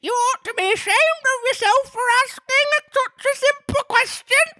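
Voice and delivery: very high falsetto Griffin scolding; mocking Griffin in a piercing falsetto; shrill Griffin. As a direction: squeal the rebuke from way up high. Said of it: "added the Griffin".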